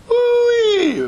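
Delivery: high, loud swooping "woo-wee"; playful, very high-pitched whoop of amazement; excited whoop. Pretend. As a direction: whoop at a huge leap. Whoops at the jump to queen.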